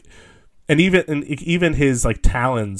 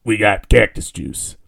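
lower, lightly put-on townsfolk voice; gruff townsfolk quote; 4 semitones lower than usual. Townsfolk answering a request for water, mock gruff.

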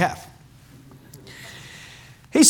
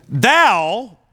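"thou" held long, higher in pitch; stress on THOU; 7 semitones higher, 5 dB louder than usual. Shown as a demonstration. Preacher showing Saul's blame of Samuel, emphatic "thou". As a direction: hit "thou" hard and stretch it. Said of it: "it's actually emphatic".

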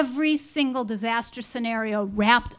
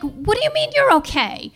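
much higher, louder incredulous question; disbelief; 11 semitones higher, 5 dB louder than usual. Retold or imitated. Wife stunned her husband isn't really hanging, incredulous. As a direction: throw it back in shock.

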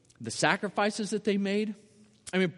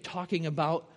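ordinary talk, nothing marked

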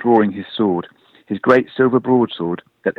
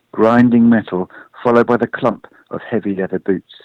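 plain reading voice

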